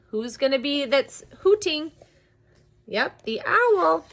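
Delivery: ordinary delivery, nothing marked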